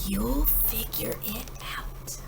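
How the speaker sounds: slow, breathy whisper. Acted. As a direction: whisper it tenderly to a sleeping child. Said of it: "They kissed her and whispered".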